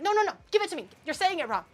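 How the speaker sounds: high, loud imitation of mother; mock-impatient high interruption; bossy mom. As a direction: cut in and take over in a mom voice. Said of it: "she's like".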